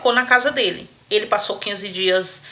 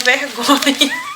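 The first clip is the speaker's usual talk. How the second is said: ordinary talk, nothing marked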